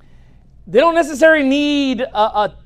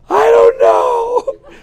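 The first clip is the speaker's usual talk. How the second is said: high, loud, drawn-out comic wail; mock bawling; 13 semitones higher, 8 dB louder, much slower than usual. Dad imitating his homesick daughter's answer, hammed-up bawling. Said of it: "she was just start bawling her eyes out".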